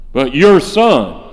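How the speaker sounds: "your son" with "your" leaned on, slow and loud; stress on your. Shown as a demonstration. Preacher pointing out the son's cold wording, stressing "your". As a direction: push hard on "your" in "your son". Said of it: "now notice, not my brother".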